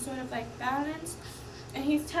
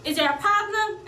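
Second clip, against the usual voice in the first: loud, higher challenging question; confrontational girlfriend; 8 semitones higher, 9 dB louder than usual. Retold or imitated. Girlfriend confronting the woman, loud and challenging. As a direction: challenge her, raised and pointed. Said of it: "she's like".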